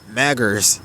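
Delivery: ordinary delivery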